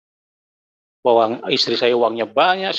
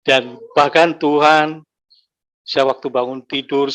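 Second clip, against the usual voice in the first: ordinary talk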